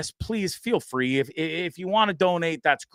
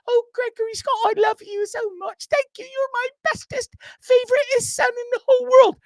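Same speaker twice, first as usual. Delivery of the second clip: much higher doting mock-mom voice; mom impression; 4 dB louder than usual. Son imitating mom thanking him, much higher, gushing. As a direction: gush like a proud mother, way up high. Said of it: "I do her voice like that".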